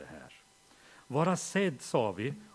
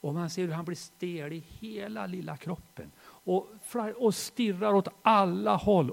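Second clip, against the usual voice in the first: ordinary talk, nothing marked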